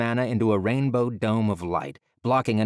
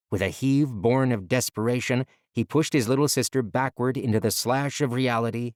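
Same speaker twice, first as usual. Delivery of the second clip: usual speaking voice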